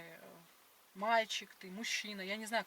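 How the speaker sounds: ordinary talk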